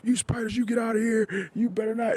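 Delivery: very high, squeaky playful threat; goofy dad chasing spiders, high-pitched; mock tough dad. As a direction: shriek at spiders to make a kid giggle. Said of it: "And I'm lifting the bed up".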